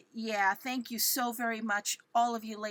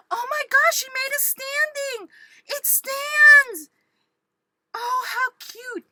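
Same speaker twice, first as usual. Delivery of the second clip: high, loud squeal of discovery; thrilled, squealing in amazement; excited amazement; 11 semitones higher, 10 dB louder than usual. Real, not acted. Crafter finding the gift stands, excited squeal.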